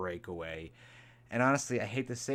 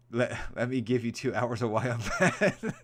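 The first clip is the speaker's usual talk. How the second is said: laughing quote; 6 dB louder than usual. Retold or imitated. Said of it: "repeating the rant line laughing".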